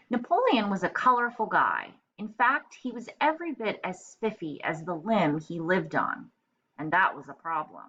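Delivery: lively read-aloud storytelling voice; story narration. A narrator's reading. Teacher reading a picture book to children, lively. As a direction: read it brightly to young kids.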